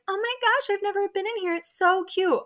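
fake innocent Monica. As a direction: parody her sweet lying tone.

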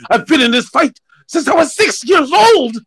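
put-on loud-chested, high French-accented movie line. Hosts redoing Cassian's fight line, hammed-up French accent. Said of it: "that's, that's my French accent".

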